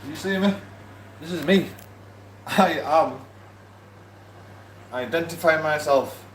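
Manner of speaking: high, loud demand to be seen; exasperated insistence. Real, not acted. Telling viewers he is a real person, loud and high. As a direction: prove you exist, exasperated.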